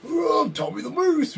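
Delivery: high, hammed-up ring voice; mocking catchphrase. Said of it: "That's what he does".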